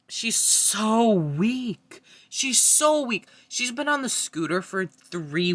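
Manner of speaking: high, loud, drawn-out so weak; exasperated outburst, stretching the so; loud rant. Real, not acted. Vlogger ranting about Jasmine, loud exasperated outburst.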